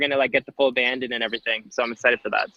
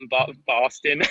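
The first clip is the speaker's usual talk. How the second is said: put-on half-hearted stab at a Boston accent; attempted Boston accent; slower than usual. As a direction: try Boston and miss. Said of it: "give us your Boston accent".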